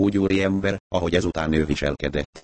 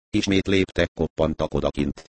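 ordinary delivery, nothing marked

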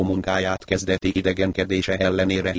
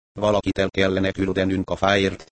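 usual speaking voice

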